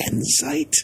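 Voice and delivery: very high, soft questioning squeak; high-pitched mock query, rising at the end. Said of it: "[name] was like".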